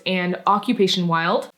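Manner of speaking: usual speaking voice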